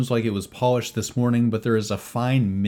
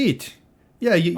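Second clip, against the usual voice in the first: high, pushy barkeep voice, much louder; jovial innkeeper; 10 semitones higher, 4 dB louder than usual. Acted. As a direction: fuss over guests like an overeager innkeeper. Tony's voice.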